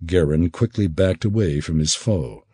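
ordinary delivery, nothing marked

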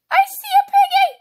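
very high, loud screaming; screaming in panic. Real, not acted. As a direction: shriek and wail in horror. Being caught, screaming.

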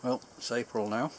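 speaking with pipe stem in mouth; talking around a pipe. Real, not acted. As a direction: pipe clenched in teeth. Seen 0: Pipe clenched in teeth until 3.5, then removed while talking.

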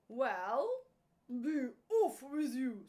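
loud, lightly voiced troll; troll dismissal. A narrator's reading. Troll letting the little goat go, loud dismissal. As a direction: send the goat off brusquely and loudly. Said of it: "said the troll".

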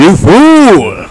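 high, loud cartoon jeer; hammed-up high yell of scorn; mock taunt. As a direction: jeer at him like a bossy kid. Voicing Lucy mocking Charlie Brown.

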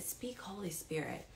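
soft, hushed whisper to the Spirit; whispered prayer. Retold or imitated. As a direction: breathe the prayer, barely voiced. Quoting her prayer in car.

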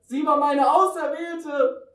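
slow, high, loud lament; hammed-up wail of heartbreak; anguished scream. A narrator's reading. Narrator cries out for his chosen one, anguished scream. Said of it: "schrie ich nochmal".

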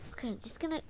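usual speaking voice

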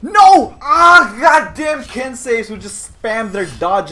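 loud, high cry of protest; exasperated high yell; dismayed shout. Real, not acted. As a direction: yell out in real frustration. Gets hit in game.

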